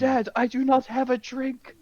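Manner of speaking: high, slow mock complaint; imitating Harry. Son left without a drink protesting to Dad, high mock complaint.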